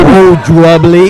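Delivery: loud, slow excited call; excited exclaim. Real, not acted. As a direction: shout as the fighters trade blows. Co-commentator reacts to exchange.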